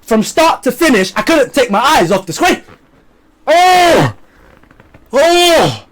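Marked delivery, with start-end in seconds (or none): 3.4-5.9 loud, high burst of awe; excited gasp. Real, not acted. Vlogger gushing to viewers about the fight scenes, thrilled gasp. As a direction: blurt it out in sheer delight.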